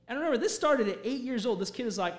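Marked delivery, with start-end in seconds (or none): none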